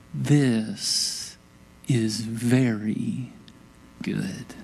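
very slow, softening and dropping low; God quietly pronouncing his creation finished; hushed reverence. Acted. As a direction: draw it out, sinking to a soft, low close. Voices God beholding creation.

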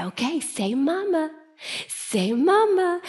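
coaxing parent. Retold or imitated. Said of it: "you look at them and you say".

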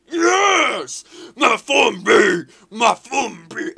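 high, full-volume victory yell; celebrating shout. Real, not acted. Gamer cheering his player in the shootout, elated shouting. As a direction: yell the player's name in triumph, drawn out.

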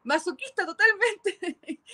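high, drawn-out laughing words. Real, not acted. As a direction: say it through a laugh. Laughs at Diego's retold urging.

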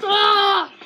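loud high cry "ah"; cartoon dizzy yell. Kid spinning the card around, a loud goofy shriek. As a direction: let out a big goofy shriek.

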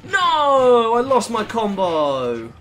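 loud, high, drawn-out wail of loss; dismayed cry. Real, not acted. Player losing his combo streak, dismayed wail. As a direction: cry out long and high, falling away.

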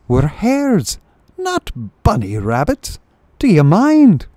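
high, huffy hare voice; indignant hare. Acted. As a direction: play the offended hare. Indignant hare answers chief.

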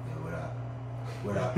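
low, very soft mumbled hello; half-swallowed, unwilling acknowledgement; reluctant greeting. Retold or imitated. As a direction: imitate a grudging greeting, barely voiced. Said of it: "reluctantly, he would be like".

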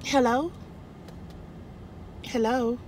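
quiet, lifted questioning hello; soft phone hello. Retold or imitated. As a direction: answer the phone softly and tentatively. Answering phone in reenacted call.

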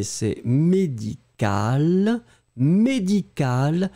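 médical very slow, with a hard "c" as "k"; slow model pronunciation. Shown as a demonstration. Teacher modelling médical against médicinal. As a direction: stretch out médical for the class. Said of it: "je vais vous prononcer les deux".

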